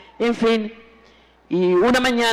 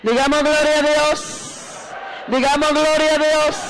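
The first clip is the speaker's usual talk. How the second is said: high, drawn-out rallying cry; ringing slow call on a high held note; preacher's praise call; 6 semitones higher than usual. Real, not acted. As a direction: rouse the congregation to praise. Calls congregation to praise.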